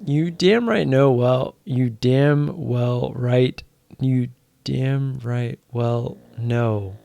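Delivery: slow, drawn-out, trying to get the phrase right; slow fumbling. Real, not acted. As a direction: stretch each word out while figuring it out. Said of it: "fumbling the phrase".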